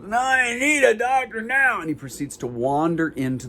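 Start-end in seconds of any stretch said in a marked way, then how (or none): 0.0-1.8 insistent homeless man. Retold or imitated. Homeless man refuses to wait in line, high insistent wail. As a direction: draw it out in a high, put-on wail. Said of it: "And he goes".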